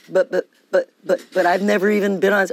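loud, panicky "but, but, but"; a rattled, loud retold objection; flustered stammering protest. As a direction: stammer out a startled refusal. Retold panicked protest about gig.

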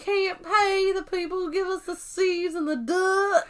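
high, slow, overdone hillbilly drawl; badly faked Southern accent, trying too hard. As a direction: imitate a struggling high-voiced hillbilly. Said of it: "he sounds like a hillbilly".

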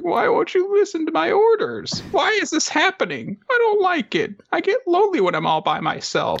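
very high cartoon wail; Nobuteru crying. Upset leader left by his crabs, high mock-crying. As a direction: whine in a hammed-up tearful squeak. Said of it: "He starts crying".

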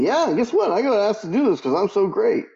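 high, boastful swagger; cocky guy. Retold or imitated. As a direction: brag like a smug teenage boy. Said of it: "He's around saying".

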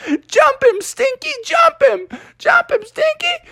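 very high squeaky cheering; shrill mouse crowd egging him on; jeering rodents. Acted. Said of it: "rodents were laughing like".

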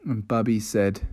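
ordinary delivery, nothing marked

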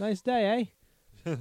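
high-pitched mock Australian accent; Australian kangaroo. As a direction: greet like a chummy cartoon Australian kangaroo. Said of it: "say you're an Australian".

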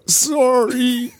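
loud, high apology; calling through wall. Retold or imitated. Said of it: "And through the wall".

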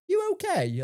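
high, lightly voiced concerned stranger; imitating a concerned woman. Shop stranger asking about his hanging arm, high mock concern. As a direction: ask it like a worried shop lady.

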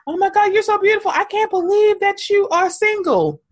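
a lightly voiced gushing man, quick and high; mock gushing suitor. Lightly mocking a date's gushing flattery, fast. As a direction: rush the flattery like a smitten date. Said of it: "I don't know".

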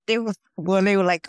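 ordinary talk, nothing marked

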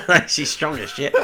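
words squeezed out through laughter; giggling through the line; laughing. Real, not acted. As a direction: laugh it out high. Laughing while describing.

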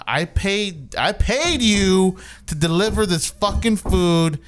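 worked-up retelling of a complaint; indignant retold rant. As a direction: spell it out slowly, exasperated. Retelling his rant at delivery driver.

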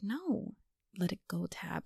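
soft whispered insistence; hushed God insisting. Pretend. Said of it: "And I'm like".

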